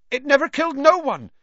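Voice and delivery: high, fast and upset; upset young Hagrid. A narrator's reading. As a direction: protest in a rush, rising. Hagrid defends his pet.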